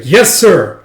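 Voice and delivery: loud barked reply; crisp yell. Retold or imitated. Soldier answering the general's order with a loud shout. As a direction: snap to attention and answer.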